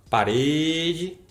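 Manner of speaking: "parede" with a long drawn-out RE; calling-out stretch. Shown as a demonstration. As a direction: call "parede", holding the middle syllable. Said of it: "qual é que demora mais".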